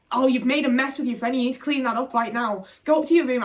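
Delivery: loud, sharp telling-off; scolding mum. Retold or imitated. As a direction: snap at the child to tidy up. Mum scolding after friends leave.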